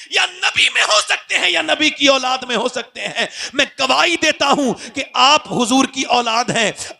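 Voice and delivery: ऊँची आवाज़ में चिल्लाकर ज़ोरदार वाज़; पूरे जोश से मजमे पर गरजना; जोशीली तक़रीर. Real, not acted. Preaching at full force. (ऊँची, ज़ोरदार आवाज़ में जोशीला वाज़)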